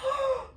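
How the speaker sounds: high, playful gasp; a hammed-up shocked gasp; mock gasp. Retold reaction to being asked.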